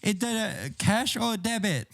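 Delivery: put-on slow, high Chinese accent voice; Chinese lady accent. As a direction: imitate a Chinese cashier's accent. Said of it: "a Chinese lady says to him".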